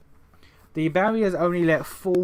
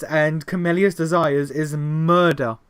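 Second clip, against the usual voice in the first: ordinary talk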